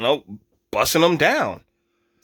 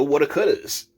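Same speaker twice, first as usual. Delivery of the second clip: ordinary talk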